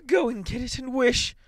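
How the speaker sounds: high-pitched urgent cry; frantic old wife's command; quivering with excitement. A narrator's reading. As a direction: urge him on in a high, excited voice. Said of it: "quivering with excitement".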